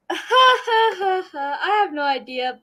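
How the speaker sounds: laughing through the words, slow; sheepish laugh while admitting it. Real, not acted. Laughs at her reading progress.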